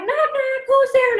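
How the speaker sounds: put-on high kid's joke voice; imitated joking kid. Kids telling knock-knock jokes, high mimicked voice. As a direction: pitch it way up like a child telling a joke.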